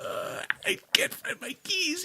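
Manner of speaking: high, slow, pitiful whimper; hammed-up whimper to get pity; whimpering fake cry. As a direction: plead like a helpless child. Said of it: "I was not angry".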